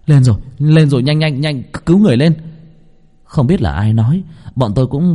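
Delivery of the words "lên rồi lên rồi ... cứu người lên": raised, louder excited shouting; urgent rescue calls. Acted. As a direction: hurry everyone to haul him up. Rescuers shout as victims surface. (hô hoán cứu người khẩn trương)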